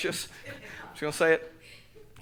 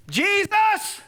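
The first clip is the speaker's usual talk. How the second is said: high, loud, desperate cry for mercy; Blind Bartimaeus; 5 dB louder, slower than usual. Acted. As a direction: call out slowly at full voice. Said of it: "This is what blind Bartimaeus does".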